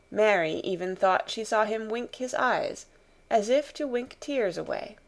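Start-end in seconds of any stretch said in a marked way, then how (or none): none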